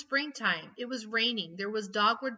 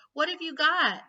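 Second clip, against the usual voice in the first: calling out; 6 semitones higher, 6 dB louder than usual. A narrator's reading. Mother calls to daughter.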